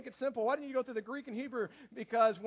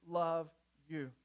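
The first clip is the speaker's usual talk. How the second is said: slow, lower, spaced-out words; simple demonstration; 5 semitones lower, much slower than usual. Shown as a demonstration. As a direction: model it word by word. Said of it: "Just say".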